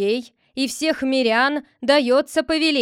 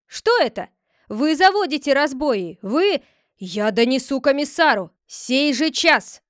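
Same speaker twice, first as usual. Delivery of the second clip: louder, higher furious ranting; 3 semitones higher, 6 dB louder than usual. Acted. The head rages at the disobedient villagers, furious. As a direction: rage at them.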